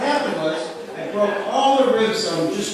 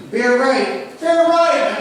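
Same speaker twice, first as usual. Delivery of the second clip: rising, louder GPS voice; GPS voice building; 6 semitones higher, 4 dB louder, much slower than usual. Retold or imitated. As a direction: repeat it with growing alarm. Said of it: "And then it says".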